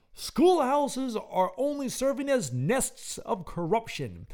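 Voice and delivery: slow, high, loud tirade; slow, loud, high moral outrage; ranting moralist. Acted. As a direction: declaim it like an outraged reformer. Reading a ranting moral pamphlet.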